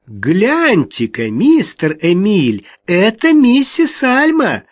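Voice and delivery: slow, high sing-song coo. A narrator's reading. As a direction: coo sweetly with false delight. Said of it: "проворковала Рут".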